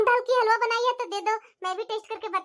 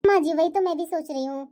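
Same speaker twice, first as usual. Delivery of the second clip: usual speaking voice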